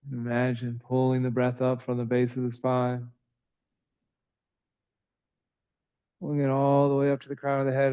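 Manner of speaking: calm, even yoga-teacher voice with long pauses; soothing, evenly paced breath cues; guided breathing meditation. Real, not acted. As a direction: guide the breath gently, in an unhurried monotone.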